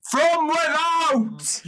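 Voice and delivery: slow, loud and high declaration; the Emperor answers the demand with a booming, drawn-out proclamation; booming repeat. Acted. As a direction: bellow it out for the back row. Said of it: "Could you say that louder?".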